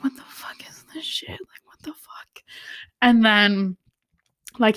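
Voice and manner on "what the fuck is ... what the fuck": very soft, voiceless disbelief; appalled whisper. Retold or imitated. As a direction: whisper as if lost for words. Appalled reaction to the plot.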